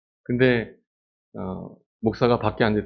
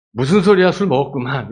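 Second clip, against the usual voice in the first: high, loud brush-off; dismissive priest; 4 semitones higher, 7 dB louder than usual. Pretend. Priest Eli brushes off Hannah, loud and scoffing. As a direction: scoff her explanation away.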